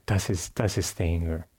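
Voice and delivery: usual speaking voice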